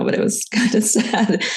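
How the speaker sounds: real laugh breaking in, voice lifting; rueful laugh over a sad memory. Real, not acted. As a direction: laugh through the sad admission. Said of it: "I'm laughing now".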